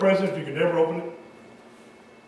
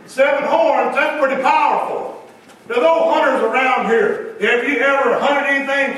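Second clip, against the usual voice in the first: louder, emphatic preaching with slowed key words; 5 semitones higher, 4 dB louder than usual. Acted. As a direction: drive each word home from the pulpit. Preaching at full force.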